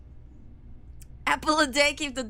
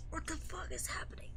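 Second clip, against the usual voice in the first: frightened unvoiced whisper; breathless whisper; 3 semitones higher, 13 dB quieter than usual. Real, not acted. Whispering while fleeing.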